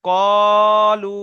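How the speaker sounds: long held chanted word; slow teaching chant; drawn-out recitation. Shown as a demonstration. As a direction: stretch the word out for students. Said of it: "যেরকম ভাবে আমি করছি".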